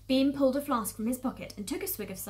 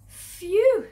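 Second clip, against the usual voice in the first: high, loud exhale of relief; exaggerated sigh of relief; relieved "phew"; 12 semitones higher, 13 dB louder than usual. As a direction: let out a big relieved phew. Fox relieved after long digging.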